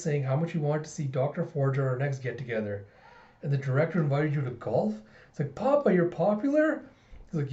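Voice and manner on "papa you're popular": high-pitched, incredulous question; amazed child. Acted. Daughter learns papa is popular, amazed. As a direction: ask papa in a high, surprised kid voice.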